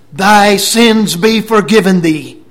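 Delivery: high, forceful preacher's quote; loud pronouncement. Retold or imitated. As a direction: proclaim forgiveness at full voice. Acts Jesus's pronouncement of forgiveness.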